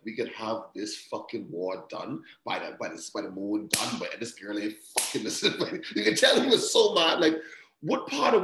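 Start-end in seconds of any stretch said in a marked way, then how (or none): 0.1-5.8 softer, lower grumbling complaint; imitating a fed-up Daemon, low and soft; muttered Daemon complaint. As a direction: grumble it under your breath like Daemon. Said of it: "I related to Damon so much when he was like".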